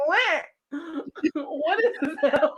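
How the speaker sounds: high, loud, slow laughing what; amazed laughing disbelief. Retold or imitated. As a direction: gasp at the apple, delighted. Amazed by apple taste.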